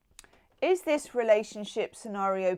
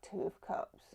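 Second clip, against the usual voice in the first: breathy whisper of "Two of Cups" with no voice; 11 dB quieter than usual. Shown as a demonstration. Tarot reader whispering the drawn card to the viewer. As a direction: whisper the card name like a secret.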